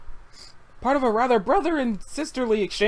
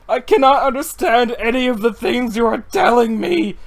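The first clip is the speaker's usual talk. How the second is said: exasperated yell; 3 semitones higher, 6 dB louder than usual. Acted. Yelling at duck.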